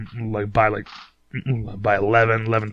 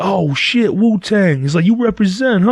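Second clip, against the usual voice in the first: hyped stranger; 8 semitones higher, 3 dB louder than usual. Retold or imitated. Hyped stranger notices Wu-Tang shirt.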